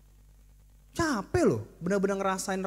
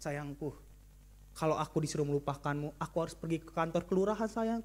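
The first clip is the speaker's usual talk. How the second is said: soft, lower, tender teasing; sweet-talking husband; 5 semitones lower, 6 dB quieter than usual. Acted. Husband softly sweet-talking his angry wife with a pun. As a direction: coax her gently like a joking husband. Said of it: "Lalu bapak-bapak bilang".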